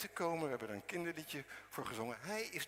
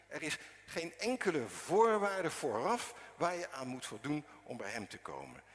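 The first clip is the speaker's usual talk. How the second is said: normal talking voice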